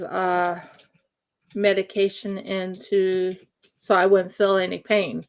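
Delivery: ordinary talk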